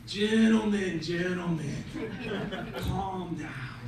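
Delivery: measured wise rabbi, pitch stepping down; calming appeal. Acted. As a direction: settle them with slow, spaced words. Wise rabbi soothes the quarrel.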